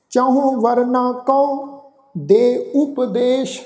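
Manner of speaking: scripture recitation. Acted. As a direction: intone each phrase with long pauses.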